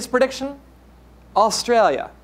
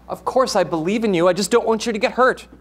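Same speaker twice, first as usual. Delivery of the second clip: ordinary delivery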